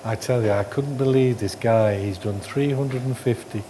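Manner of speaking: ordinary delivery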